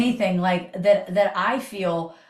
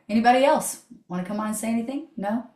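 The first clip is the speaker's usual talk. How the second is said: ordinary delivery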